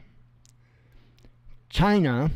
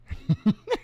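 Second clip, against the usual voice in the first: amused laugh; 7 semitones higher, 4 dB quieter than usual. Real, not acted. Laughs at own joke.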